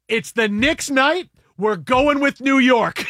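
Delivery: loud, high, slow and emphatic, half laughing; excited declaration. Retold or imitated. Telling teammates the final answer, loud and excited. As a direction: proclaim it like a sure bet. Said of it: "And I just go".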